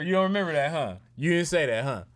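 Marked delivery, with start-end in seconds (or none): none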